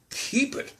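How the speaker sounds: high, breathy, disbelieving; incredulous. A narrator's reading. Nephew echoes his uncle in disbelief, high and breathy. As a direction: echo it in disbelief. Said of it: "repeated Scrooge's nephew".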